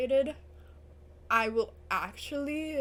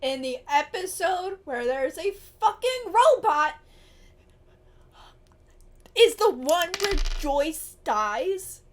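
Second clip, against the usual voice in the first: loud, upset, slowing at the end; distressed loud rant; 4 semitones higher, 10 dB louder than usual. Real, not acted. Viewer upset that Joyce dies, loud distressed rant. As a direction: vent the shock at full voice.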